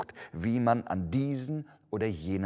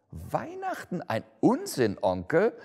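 incredulous call, rising on Onkel; nephew exclaiming; 6 semitones higher than usual. A narrator's reading. Nephew to his uncle, lightly voiced incredulous exclaim. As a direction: protest in disbelief. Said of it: "rief der Neffe".

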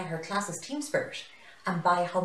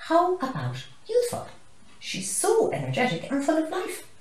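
excited; 12 semitones higher, 6 dB louder than usual. Acted. Warthog proposing a word.

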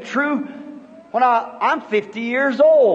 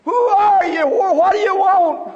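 startled call; 9 semitones higher, 4 dB louder than usual. Retold or imitated. Startled, calling to unseen voice.

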